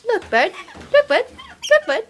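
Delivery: high, repeated pet-talk call; coaxing a wild bird. Real, not acted. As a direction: call the bird's attention. Calling bird's attention.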